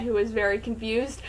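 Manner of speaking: ordinary delivery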